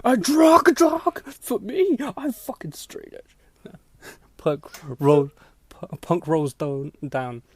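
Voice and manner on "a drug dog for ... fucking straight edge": high, loud, slow disbelief; can't believe the accusation; a squeaky, stunned protest; incredulous outrage. Retold or imitated. Outraged at the drug dog.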